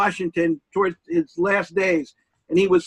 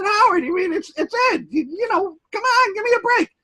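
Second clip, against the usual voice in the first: much higher, incredulous pleading; exasperated protest; 13 semitones higher than usual. Retold or imitated. Guest retelling how he pleaded with Howard, exasperated. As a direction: protest in disbelief, voice climbing.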